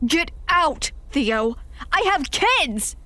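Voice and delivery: a father shouting an intruder out, slowing on the last words; furious shout. Acted. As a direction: yell him out of the house for the kids' sake. Dan throws Theo out.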